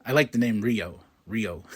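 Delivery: ordinary talk, nothing marked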